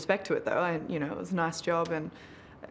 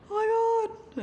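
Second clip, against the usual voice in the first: retold crying. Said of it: "I was just crying, like".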